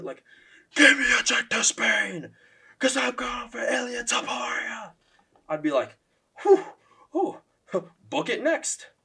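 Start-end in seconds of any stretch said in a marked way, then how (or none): 0.7-4.9 much higher, louder and slow shouted call-out; Michael Chandler energy. Pretend. Vlogger shows how the callout should sound, a high slow fired-up shout. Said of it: "if he gave that Michael Chandler energy". 6.4-8.9 excited fan. Pretend.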